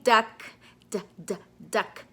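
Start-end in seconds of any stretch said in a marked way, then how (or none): none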